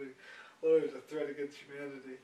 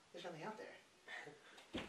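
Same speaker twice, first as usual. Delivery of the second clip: slow, breathy whisper, drawing out the suspense; hushed spooky whisper; 16 dB quieter, much slower than usual. Retold or imitated. Telling friends the night cow story, slow suspense whisper. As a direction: whisper it like a campfire ghost story.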